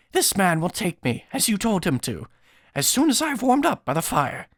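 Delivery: put-on high, strained old man's voice; old beggar Odysseus. Old beggar telling his host he will head to town, high-voiced. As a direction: plead as a weary old beggar, pitched well up.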